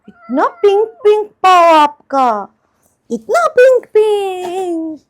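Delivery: loud, high cooing over a pet. Real, not acted. Owner gushing to the cat over pink paws, excited baby talk. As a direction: squeal it adoringly, stretching the last word.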